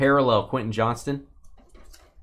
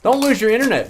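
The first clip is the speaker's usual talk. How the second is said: loud plea; 8 semitones higher, 7 dB louder, faster than usual. Real, not acted. Urging a viewer not to drop.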